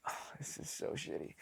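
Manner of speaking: very soft, whispered grumble; hushed dismay. Retold or imitated. Leader grumbling as his man won't wake up, hushed dismay. Muttered dismay at a sleeping squadmate.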